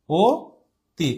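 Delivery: অতি opening on a closed "o", said slowly "o... ti"; closed O pronunciation. Shown as a demonstration. Teacher showing how the spelt অ is spoken, closed O in অতি. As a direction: split অতি slowly, first sound a rounded "o". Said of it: "এরকম উচ্চারিত হয়".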